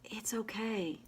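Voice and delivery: a hushed, comforting whisper; soothing whisper. Shown as a demonstration. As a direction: whisper it gently to yourself. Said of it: "calming and soothing this part of me".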